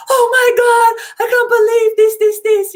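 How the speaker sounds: high, loud, thrilled exclamation; excited clients. Retold or imitated. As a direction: squeal with disbelief and joy. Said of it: "they're so excited".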